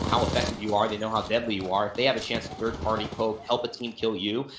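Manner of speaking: usual speaking voice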